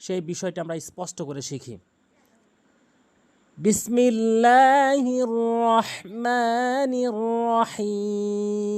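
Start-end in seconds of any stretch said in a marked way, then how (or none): 3.6-8.7 slow, drawn-out melodic recitation; slow sung tajweed recitation; Quranic recitation. Shown as a demonstration. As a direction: chant it slowly like tilawat.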